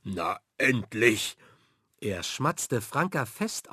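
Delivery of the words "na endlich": slow, relieved pirate cry; finally, with a big grin; mock glad pirate; delighted. A narrator's reading. Ein Strahlen ging über sein Gesicht.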